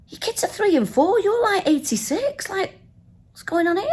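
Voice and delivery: high-pitched mock disbelief; incredulous. Passenger seeing old driver's young kids, incredulous. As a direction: question it in bafflement, pitch soaring.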